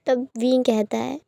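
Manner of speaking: ordinary delivery, nothing marked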